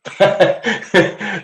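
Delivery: a sudden high peal of laughter; big high laugh. Real, not acted. Reading a funny chat comment, a high burst of laughter.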